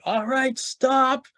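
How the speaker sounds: imitated booming yell, pitched well up. Session leader halting a shoving crowd, booming yell. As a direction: call a halt like a big-voiced leader. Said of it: "huge booming voice, and he just yells out".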